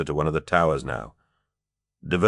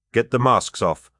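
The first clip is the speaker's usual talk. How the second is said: a raised call to the riders; 6 semitones higher, 4 dB louder than usual. A narrator's reading. De Verck calling to his fellow riders, raised voice. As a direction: call out over the hooves. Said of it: "de Verck called".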